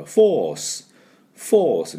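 teacher demonstrating force in a British accent. Shown as a demonstration. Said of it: "イギリス人だとこの中のRの音を入れませんから".

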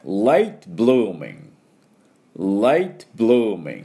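"late blooming" very slowly, both words stretched; slow teacher's demo of "late blooming"; pronunciation model. Shown as a demonstration. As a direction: draw out LATE and bloo, pitch falling on each. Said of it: "ていうふうに言います".

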